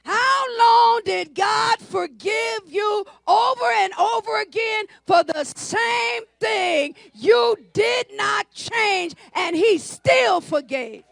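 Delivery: slow, drawn-out preacher's shout; stretched, ringing sermon delivery; full-force preaching. Real, not acted. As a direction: drive each phrase home to the congregation.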